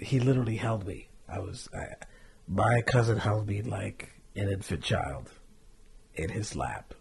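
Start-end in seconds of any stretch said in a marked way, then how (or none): none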